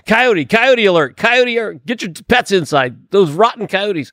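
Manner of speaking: hammed-up warning announcement; mock alarm call. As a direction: imitate a fussy neighbor sounding the alarm. Mimics alarmed neighbors posting coyote alerts.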